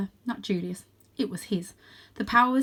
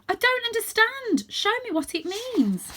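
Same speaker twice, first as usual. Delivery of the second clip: higher voice, slowing on the plea; young cat pleading; 4 dB louder than usual. A narrator's reading. Young Varjak begs his ancestor, high and pleading. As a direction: beg to be shown.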